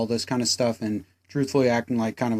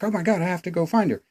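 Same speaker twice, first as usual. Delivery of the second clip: higher, hurried mock panic; mock urgency; 7 semitones higher, faster than usual. Reviewer voices Audrey deciding to chase Gina, mock urgency. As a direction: rush it out as a frantic character. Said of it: "she's like".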